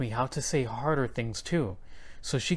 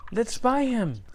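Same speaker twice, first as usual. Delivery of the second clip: eager plea; 9 semitones higher, 3 dB louder than usual. A narrator's reading. Child begging mother.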